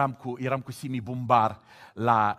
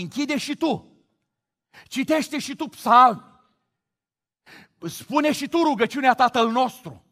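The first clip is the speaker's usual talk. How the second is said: higher, louder pleading call; urgent exhortation; 9 semitones higher, 7 dB louder than usual. Acted. Preacher urging a newcomer to pray, loud. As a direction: urge the listener to come.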